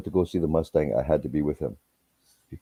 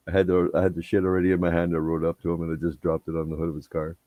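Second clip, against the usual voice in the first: usual speaking voice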